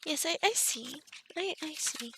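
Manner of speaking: usual speaking voice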